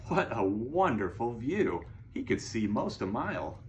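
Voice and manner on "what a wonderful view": plain reading voice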